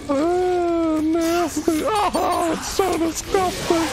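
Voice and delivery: high squealing disgust; disgusted high cry. Real, not acted. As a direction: shriek in revulsion. Reacting to gross burning goo.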